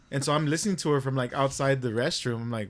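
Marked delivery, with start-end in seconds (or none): none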